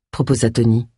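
ordinary delivery, nothing marked

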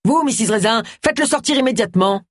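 high-pitched, exasperated command; yelled order. A narrator's reading. Vicar ordering Agatha to get Roy out, yelled order. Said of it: "hurla le pasteur".

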